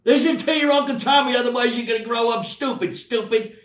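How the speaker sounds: put-on high, loud gruff old uncle lecturing; Uncle Tommy. Uncle lecturing nephews they'll grow up stupid, high and loud. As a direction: scold the kids like a cranky old uncle. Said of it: "And he would go like this".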